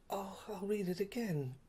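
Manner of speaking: breathy whispered aside to himself. Real, not acted. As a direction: whisper it, half to yourself. Aside while rereading the notes.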